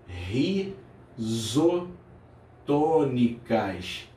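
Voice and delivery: risotônicas stretched very slowly, every syllable held; drawn-out key term. Shown as a demonstration. Teacher to student fixing the term, very slow. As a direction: spell risotônicas out in sound, very slowly.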